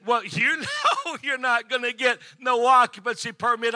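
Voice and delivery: laughing scornfully through the line, loud; mocking laugh. City inspector laughing at the reverend, mocking laugh. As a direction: laugh him off as hopeless.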